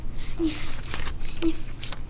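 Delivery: soft unvoiced mock sniffing; pretend sniffle. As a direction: sniffle sadly. Gerald sniffles sadly.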